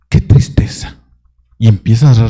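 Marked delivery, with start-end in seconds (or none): 0.1-1.0 mock self-pity.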